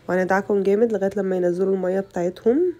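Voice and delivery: normal talking voice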